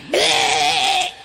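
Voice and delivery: taunting bleh with tongue out; tongue-out raspberry. Acted. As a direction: blow a silly raspberry. Sticks tongue out at shadow.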